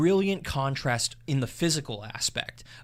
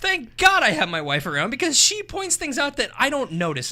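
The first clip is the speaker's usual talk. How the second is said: excited praise; 11 semitones higher, 5 dB louder than usual. Real, not acted. Praising wife, continuing high.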